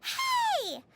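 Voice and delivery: high-pitched indignant yelp; indignant protest. Acted. Paimon protests the traveler's teasing, indignant yelp. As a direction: squeak it out, offended.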